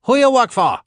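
loud, high, harsh shout; shouted question. A narrator's reading. Soldier yells at the captive, loud and harsh. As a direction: yell it at the prisoner.

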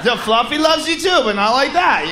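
loud high panicked no no no; dad shouting at his son to put the cat down; panicked parent. Acted. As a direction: yank the kid back with loud frantic nos.

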